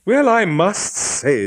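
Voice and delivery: slow, icy, disdainful drawl; cold voice. Acted. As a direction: freeze them out slowly. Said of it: "the cold voice of Hypatia Wace".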